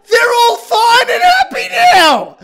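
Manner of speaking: high, loud, slow laughing sarcasm; laughing mock. Real, not acted. Mocking the film's sudden happy ending, laughing and slow.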